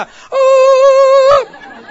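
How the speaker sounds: high loud startled shout; shocked scream. Retold or imitated. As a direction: yelp of amazement at the sudden find. Shock opening the bus bathroom.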